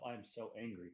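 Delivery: ordinary delivery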